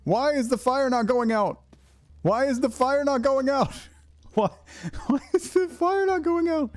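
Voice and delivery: high, frantic repeated question; panicked. Real, not acted. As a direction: plead with the fire. Fire won't go out.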